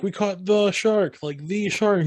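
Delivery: high, slow mock boast; mock proud cheer. Fishermen showing off their catch, high and slow. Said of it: "they're like".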